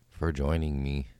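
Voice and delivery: normal talking voice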